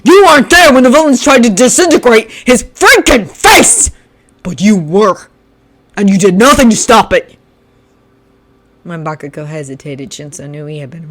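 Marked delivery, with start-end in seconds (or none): 0.0-7.4 loud, higher angry voice; furious accusation. Acted. Shinso accusing Bakugo, loud and raised. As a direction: throw the blame at him.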